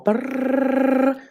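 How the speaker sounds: rolled lips drum roll; imitated drum roll; drum roll sound. Shown as a demonstration. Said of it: "we will have a drum roll".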